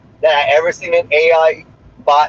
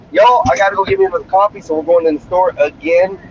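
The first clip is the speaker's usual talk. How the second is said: normal talking voice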